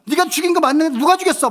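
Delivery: high, loud mock accusation; mock interrogation shout. Dad cornering his youngest son, playfully accusing shout. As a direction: press the teased child for an answer, loudly. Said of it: "막 큰 소리로 막".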